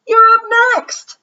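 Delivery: high, loud call. Acted. Emilio telling Justin his turn is next, called out high. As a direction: shout it across the court. Emilio calls out across playground.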